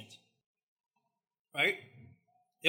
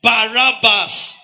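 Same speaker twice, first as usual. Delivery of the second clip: loud, higher, slow stretched Barabbas; insistent Pilate; 5 semitones higher, 11 dB louder, much slower than usual. Acted. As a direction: lean on the name in disbelief. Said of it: "He'd be like".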